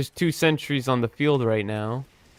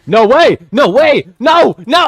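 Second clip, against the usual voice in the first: loud, high cries of disbelief; disbelieving shout; 10 semitones higher, 10 dB louder than usual. Real, not acted. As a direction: yell no way in shock. Disbelief during gameplay.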